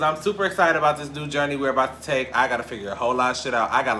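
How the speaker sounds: ordinary talk, nothing marked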